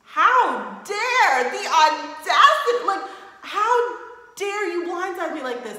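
high, loud, laughing indignation; playfully furious at the betrayal; mock outrage. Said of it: "I would have been like".

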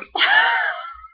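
high, loud shrieking laugh. Real, not acted. Laughing at own pizza man story, shrieking. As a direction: crack up at your own story. Cracking up after punchline.

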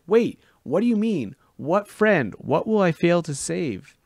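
high, loud desperate questions after the vanishing mist; urgent cry. Acted. The boy to the retreating oracle, desperate cry. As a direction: call out frantically. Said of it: "I cried".

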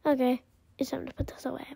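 quick soft whisper; fast whisper. Real, not acted. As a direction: whisper it hurriedly. Whispered aside.

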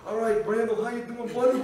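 Milo Ventimiglia. Acted. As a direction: lift the pitch into a hailing character voice. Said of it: "Milo Ventimiglia, This Is Us".

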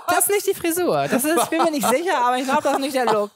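high, laughing mock dismay; dismayed young self. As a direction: squeak out the horror at the haircut. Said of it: "und war so".

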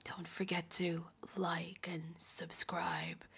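slow, breathy seductive whisper; sexy whisper. Pretend. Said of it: "why do you sound like Dracula".

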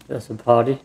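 ordinary delivery

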